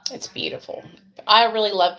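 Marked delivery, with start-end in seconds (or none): 0.1-0.8 a breathy hush of awe; soft unvoiced "It's beautiful"; whispered. Real, not acted. Repeats the praise, breathless hush.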